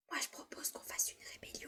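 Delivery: a conspiratorial whisper to classmates; sneaky whisper. Acted. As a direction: whisper it behind the teacher's back. Pupil whispering during silent class. (chuchotement complice)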